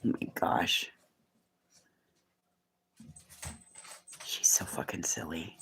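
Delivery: hushed amused whisper about her. Real, not acted. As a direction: whisper so she won't hear. Whispering about someone nearby.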